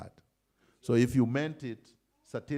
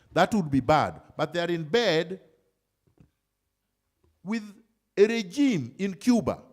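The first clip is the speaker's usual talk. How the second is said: high, loud rally speech; impassioned political oratory; 9 semitones higher, 7 dB louder than usual. Real, not acted. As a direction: drive each point home to the crowd, high and forceful.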